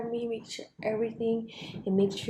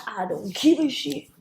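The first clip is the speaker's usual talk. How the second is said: big and bad; 4 semitones higher, 4 dB louder than usual. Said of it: "big and bad like God".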